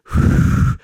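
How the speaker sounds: loud blow into the microphone; breathy fake wind gust; imitated wind. Shown as a demonstration. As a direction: blow hard, pretending to be wind. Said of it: "blowing into the microphone pretending to be the wind".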